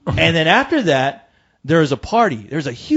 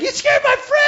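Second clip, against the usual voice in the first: mock friend. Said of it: "her friend's like".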